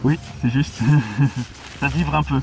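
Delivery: muffled behind a gas mask; through a gas mask. Real, not acted. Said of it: "On vous entend respirer".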